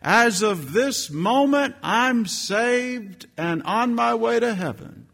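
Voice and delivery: high, bright testimony voice of an eager lady; church lady testifying. Retold or imitated. Lady standing up at testimony time, eager high voice. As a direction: play the lady giving her testimony. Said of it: "a lady who got up in a church".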